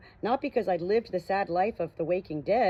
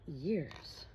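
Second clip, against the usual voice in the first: years muttered much softer, under the breath; 2 semitones lower, 10 dB quieter than usual. A narrator's reading. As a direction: murmur years to yourself in disbelief. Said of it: "Joshua muttered the word".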